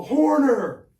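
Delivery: loud call of the name Horner, high and sharp; a guard shouting Horner down the corridor; guard's shout. Retold or imitated. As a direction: bark the surname across the cellblock. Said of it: "I get this call".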